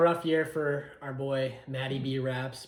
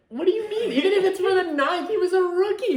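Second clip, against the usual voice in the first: indignant protest; 8 dB louder than usual. Real, not acted. Playful protest over draft pick.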